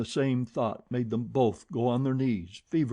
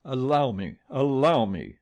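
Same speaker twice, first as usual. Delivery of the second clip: louder, firm command; authoritative; 2 semitones higher, 6 dB louder than usual. A narrator's reading. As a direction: take charge of the room. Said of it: "authoritatively ordered him".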